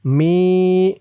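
"মি" with a long held i; one-alif stretch. Shown as a demonstration. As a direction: draw out "মি" slowly. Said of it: "মি এক আলিফ টান". ("মি" এক আলিফ টেনে)

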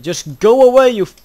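high, loud plea to be left alone; frantic yell. Real, not acted. Player being chased in game, frantic yell. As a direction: yell it at the attacker. Pursuer attacking again.

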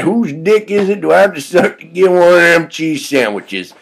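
high, loud hobo character voice; put-on voice, pitched well up; Frank the vagrant. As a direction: play an eager, shameless bum.

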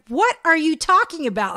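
high, loud exasperated question; irritated. Retold or imitated. Friend denying the bacon story, getting irritated. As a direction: snap it in mock irritation. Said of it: "she's getting all irritated".

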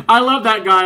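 bursting out with a laugh. Real, not acted. Laughing after the clip.

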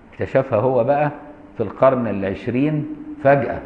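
usual speaking voice

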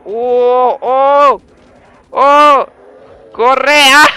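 loud high shouts; real hype yelling; excited yells. Real, not acted. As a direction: yell as the horde arrives. Zombie wave hits.

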